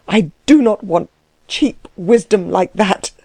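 ordinary delivery, nothing marked

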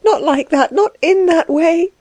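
high, halting, tearful apology; distressed weeping woman, pitched high; sobbing. Acted. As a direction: sob through it in a high, breaking-off voice. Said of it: "she sobbed".

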